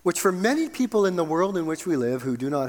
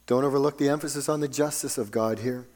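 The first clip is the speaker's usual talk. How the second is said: ordinary talk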